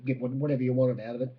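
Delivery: ordinary talk, nothing marked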